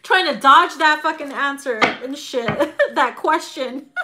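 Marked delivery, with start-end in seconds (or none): none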